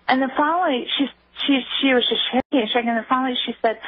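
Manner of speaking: ordinary talk, nothing marked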